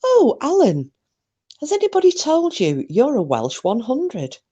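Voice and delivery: high, teasing taunt; high playful teasing jab; mock teasing. Shown as a demonstration. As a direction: tease him in a sing-song high voice. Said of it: "next time somebody goes".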